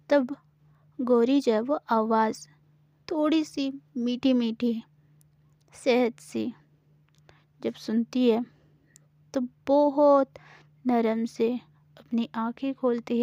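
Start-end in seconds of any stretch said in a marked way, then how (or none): none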